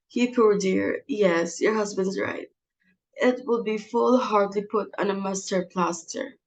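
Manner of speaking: normal talking voice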